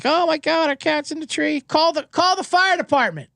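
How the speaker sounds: high-pitched imitation of a tearful, panicked girlfriend; mock crying girlfriend. Girlfriend pleading with her boyfriend to call the fire department, high mock panic. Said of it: "his girlfriend Allison would start crying".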